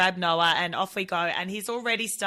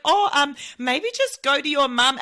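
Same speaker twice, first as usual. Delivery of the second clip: higher, louder flustered lady; awkward mum, a bit higher and louder; awkward stranger; 6 semitones higher, 6 dB louder than usual. Retold or imitated. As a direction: fluster like a caught-out passer-by.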